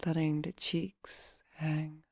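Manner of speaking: soft, unhurried meditation voice; guided meditation. Acted. Meditation coach relaxing listeners' face and jaw, soft guiding voice. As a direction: guide them gently into relaxation, leaving long pauses.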